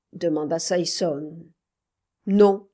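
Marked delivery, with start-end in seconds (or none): 2.3-2.7 loud, curt refusal; gruff. A narrator's reading. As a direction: answer gruffly in one loud word. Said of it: "dit l'autre bourru".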